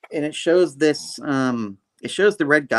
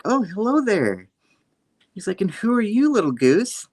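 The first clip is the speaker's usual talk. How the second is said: high cooing Spidey; 9 semitones higher than usual. Retold or imitated. Spider-Man meeting a goose, cooing up high. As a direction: coo up high like greeting a pet.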